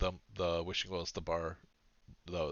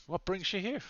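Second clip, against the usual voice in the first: higher-pitched stab at northern English; tentative northern English character voice, pitched up; attempted northern English accent; 11 semitones higher than usual. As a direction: lift into a rising northern English question. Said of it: "I can't do the northern English".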